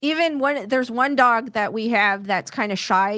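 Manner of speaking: ordinary talk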